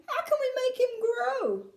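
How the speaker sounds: high, pleading wail; distressed wail. A narrator's reading. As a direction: wail it beseechingly. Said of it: "wailed Missus T V".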